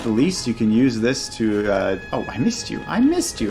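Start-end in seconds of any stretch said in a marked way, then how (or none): 2.3-3.5 startled, pitch jumping high; surprised high squeak. Real, not acted. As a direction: yelp it in surprise. Surprised during werewolf fight.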